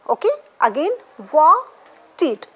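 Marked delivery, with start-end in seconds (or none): 1.3-2.5 "wanted" as wan, pause, ted; louder "wanted", broken clearly in two; split into two syllables. Shown as a demonstration. Teacher counting two syllables for students, split apart. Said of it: "two syllable".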